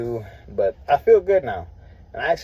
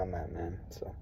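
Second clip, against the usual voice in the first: whisper; 8 semitones lower, 19 dB quieter than usual. Real, not acted. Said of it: "trailing whisper".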